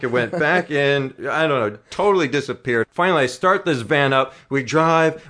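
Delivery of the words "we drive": normal talking voice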